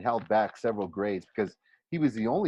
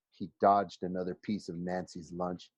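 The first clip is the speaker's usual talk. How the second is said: ordinary delivery, nothing marked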